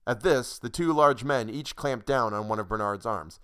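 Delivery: plain reading voice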